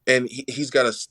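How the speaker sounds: ordinary delivery, nothing marked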